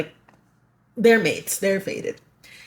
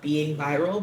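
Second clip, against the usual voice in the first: ordinary delivery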